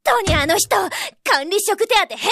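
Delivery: ordinary talk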